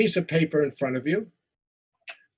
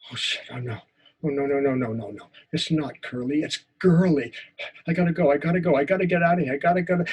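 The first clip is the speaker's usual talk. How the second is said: acted first-person story of a shy boy; a teacher acting out a memory story; story performance. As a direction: tell it as the scared twelve-year-old. Performs a story monologue for the class.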